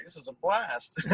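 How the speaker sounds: high, altered happy-stoned voice; stoned kid voice. Retold or imitated. His teenage self while friends threw up, giddy. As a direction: sound blissfully baked. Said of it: "I was sitting there just like".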